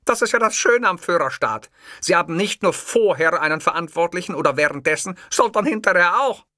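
hammed-up dictator pride; proud Hitler. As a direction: boast of the Führer state. Hitler voiced, raised.

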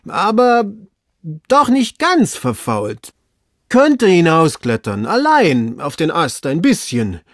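high, slow, loud, drawn-out hedging; hedging servant. Acted. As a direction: concede it reluctantly from up high. Servant hedges from the tree.

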